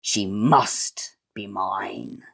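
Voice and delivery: slow whispered menace; slow stern whisper; stern villain whisper. Acted. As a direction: hiss it out slowly, sinister. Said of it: "sternly snapped the sinister squire".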